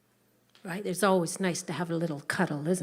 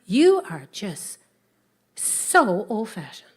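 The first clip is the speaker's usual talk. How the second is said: attitude; 7 dB louder, slower than usual. Acted. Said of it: "Jack with the attitude".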